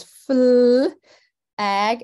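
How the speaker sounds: "Fl-" held long and loud, pause, then "ag"; loud drawn-out "Fl-", then a separate "ag"; imitating segmented blend. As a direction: imitate a pupil splitting flag into "Fl-, ag". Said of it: "And then she says".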